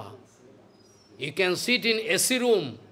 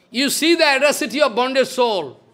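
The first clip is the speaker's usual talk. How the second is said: higher and louder second time; emphatic repeat; 6 semitones higher, 6 dB louder than usual. Shown as a demonstration. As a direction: drive the line home, louder.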